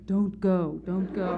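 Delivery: small voice of doubt behind you, lightly acted; lightly voiced inner warning; little inner voice. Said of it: "with this little voice behind me going".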